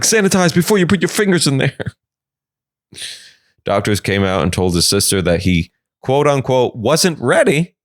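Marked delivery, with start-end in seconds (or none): 0.0-1.9 fussy doctor. Retold or imitated. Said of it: "The doctors are like".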